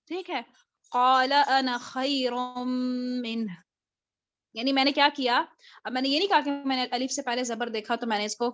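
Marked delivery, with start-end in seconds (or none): 0.9-3.5 slow continuous recitation of قال انا خیر منہ, the alif of انا not lengthened; slow Quran recitation. Shown as a demonstration. Teacher reciting Iblis's words قال انا خیر منہ in continuation, slow. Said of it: "continuation میں پڑھوں گی".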